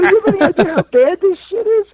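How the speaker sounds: very high, loud, laughing mock yell; laughing and fighting. Mid-argument with his friend, loud laughing yell. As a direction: yell it while cracking up. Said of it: "we're laughing and fighting and".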